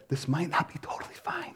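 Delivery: whispered doubt from the back of the mind; a nagging whisper, under the breath; inner voice whisper. Retold or imitated. Pastor voicing the doubt in his head, whispering. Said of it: "something in the back of my head said".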